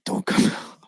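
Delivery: hushed, nearly whispered warning; retold plea under the breath; urgent private plea. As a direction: plead quietly so nobody hears. Privately willing the friend to stay inside.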